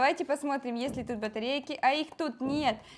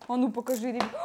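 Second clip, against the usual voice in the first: ordinary delivery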